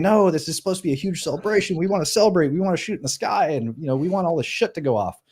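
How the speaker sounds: high-pitched excited pleading; acting out an excited celebrant; imitated eager explaining. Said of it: "he starts explaining".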